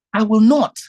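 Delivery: loud, emphatic refusal; firm refusal. Real, not acted. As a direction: put your foot down, loudly. Refusing to return to stand.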